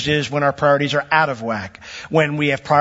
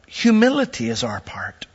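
whispered; 6 semitones higher than usual. Real, not acted. Preacher drops to a whisper.

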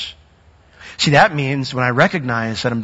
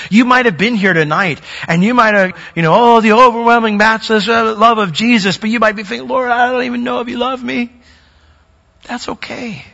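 rising, louder preaching build; 9 semitones higher, 4 dB louder than usual. Acted. Pastor to congregation, building preaching. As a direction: press the congregation, building in pitch.

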